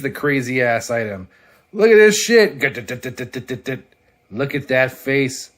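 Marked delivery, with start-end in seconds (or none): 1.7-2.6 loud, high burst of delight; excited. Real, not acted. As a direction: show the page off at full volume. Showing a comic page.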